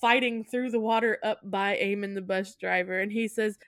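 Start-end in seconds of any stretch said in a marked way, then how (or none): none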